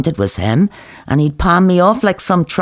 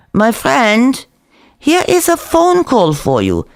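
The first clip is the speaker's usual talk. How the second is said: higher, lilting Israeli café owner; Zeev's voice; 8 semitones higher than usual. Acted. Cook calling the narrator to the phone, playful. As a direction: call a friend to the phone, playful.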